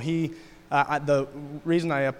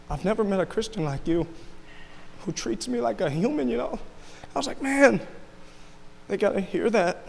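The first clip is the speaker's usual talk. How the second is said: tearful; 6 semitones higher than usual. Real, not acted. Crying while recalling what his Estonian friend said. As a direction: voice breaking high with emotion. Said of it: "sorry for just crying on you guys".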